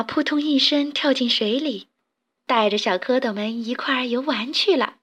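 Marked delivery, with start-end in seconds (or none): none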